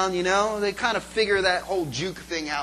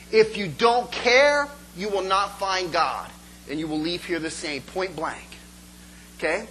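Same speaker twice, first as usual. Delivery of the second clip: ordinary talk, nothing marked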